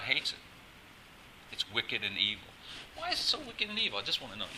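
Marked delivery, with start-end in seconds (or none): 3.0-4.5 high, quick, soft protest; whining questioner. Acted. Listener asking the preacher why it's wicked, whiny protest. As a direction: plead in a small, higher voice. Voices a questioner challenging him.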